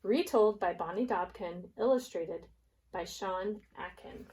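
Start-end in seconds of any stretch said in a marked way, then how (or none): none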